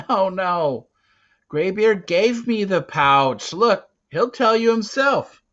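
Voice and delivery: high, loud, urgent pleading; frightened boy insisting he is innocent; anxious denial. Acted. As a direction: beg them to believe you. Boy denies theft, pleading.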